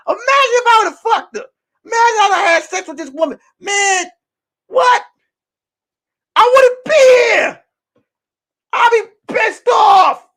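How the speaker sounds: high, loud disbelieving shout; incredulous yelling. Real, not acted. Relief at near miss.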